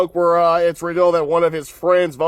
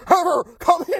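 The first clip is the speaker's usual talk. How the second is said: Darth Vader parody; 10 semitones higher than usual. Retold or imitated. Head greenskeeper calling Heifer.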